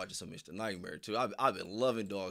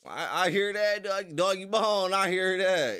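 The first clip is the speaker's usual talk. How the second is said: excited; 9 semitones higher, 9 dB louder, slower than usual. Real, not acted. Hyped greeting to a viewer.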